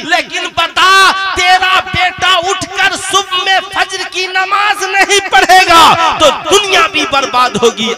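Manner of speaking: high, loud sermon cries to the crowd; fiery preaching. Acted. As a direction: rouse the congregation, shouting. Preaching at full force.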